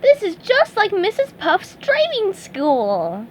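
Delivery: delighted comparison; excited. Acted. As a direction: SpongeBob enjoying the crashes. Bumper boat ride fun.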